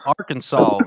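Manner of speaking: "Arkansas" ending in "sawl", said low; odd Arkansas pronunciation. Real, not acted. Answering a friend's teasing, "Arkansas" low, ending like "sawl". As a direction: repeat "Arkansas" low, with an L-like tail. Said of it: "Why you say Arkansas?".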